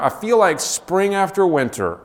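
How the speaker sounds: high and bright with wonder as Sam; joyful exclaim. Acted. Sam tells Gandalf how he feels, excited and high. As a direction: sing out the feeling of spring. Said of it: "he cried".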